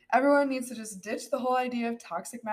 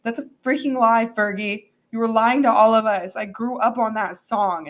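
ordinary talk

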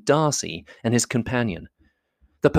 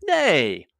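high, animated objection; good-natured exclamation, pitched high; cried out; 6 semitones higher than usual. A narrator's reading. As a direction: break in with a protesting cry. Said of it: "cried Bingley".

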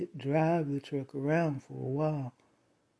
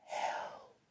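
whispered, drawn-out cry for help; barely audible, like screaming from inside a soundproof box; faint muffled scream; 16 dB quieter, slower than usual. Retold or imitated. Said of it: "the voice called out".

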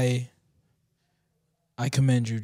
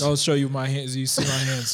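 1 mock surrender; 7 semitones lower than usual. Mock surrender, hands shown.

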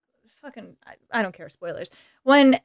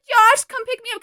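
higher, pleading put-on distress; mock hysterical Cher; 9 semitones higher than usual. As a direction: beg for rescue, hammed-up. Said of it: "She's putting on a hysterical".

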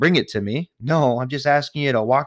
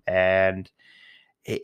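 ordinary delivery

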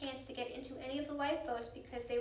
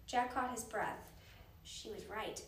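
ordinary delivery

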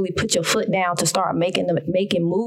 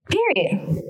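"Period." said high and final, one hard word; emphatic period; 8 semitones higher than usual. Real, not acted. To viewers about saving money, a firm high "Period.". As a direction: land "Period." like a full stop. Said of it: "And I just said period again".

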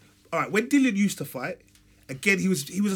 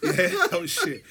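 laughing. Real, not acted. Laughing at his own bad accent.

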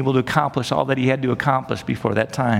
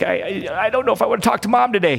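quick, higher, reluctant mumble of a tired daughter; weary aunt; 7 semitones higher, faster than usual. Retold or imitated. Aunt dreading another call from her mom, high and rushed. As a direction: rattle it off like dreading the phone call. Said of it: "she would go".

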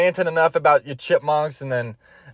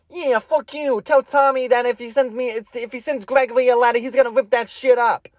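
tough guy Gregory; 5 semitones higher than usual. Pretend. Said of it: "Cuts to Gregory".

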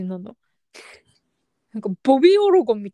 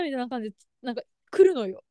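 ordinary delivery

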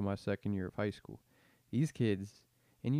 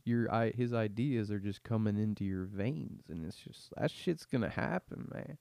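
ordinary talk

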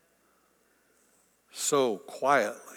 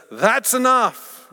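high, louder and slow; commanding; 8 semitones higher, 6 dB louder, slower than usual. Acted. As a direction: halt them firmly. God stops the chaos.

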